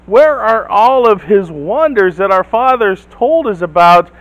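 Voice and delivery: blurting out. Retold or imitated. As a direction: blurt it out in protest. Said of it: "Gideon blurts out".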